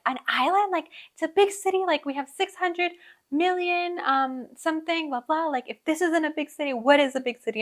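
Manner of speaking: high-pitched mocking imitation of offended locals; mock indignant commenters. Mocking indignant online commenters going off.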